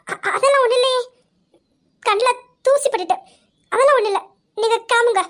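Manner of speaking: tearful denial. Acted. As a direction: deny it while holding back tears. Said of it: "எதுக்காக அழுகுற?". (அழுகையை அடக்கி மறுக்கும் குரலில்)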